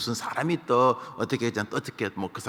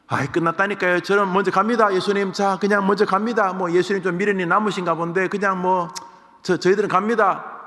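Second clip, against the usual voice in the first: higher, louder exasperated dismissal; impatient brush-off; 9 semitones higher, 7 dB louder than usual. Retold or imitated. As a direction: wave him off and walk away. Bystanders dismiss Jesus and leave.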